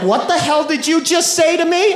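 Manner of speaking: high-pitched, incredulous comeback; indignant disbelief, played for laughs; mock outrage. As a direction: act affronted, voice shooting up. Mock offence at mom's insult.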